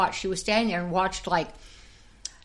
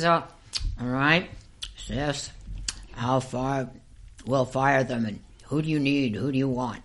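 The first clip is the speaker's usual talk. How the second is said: lower, measured mock director voice; director's resigned reply; 6 semitones lower than usual. Director giving in to dancer about firing girls, low and resigned. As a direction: give in, pitched low and matter-of-fact.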